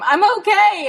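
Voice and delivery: high, loud call from far off; shouting back. Retold or imitated. Stuck Jen answering her searching dad, shouting high and loud. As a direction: yell so he can hear you.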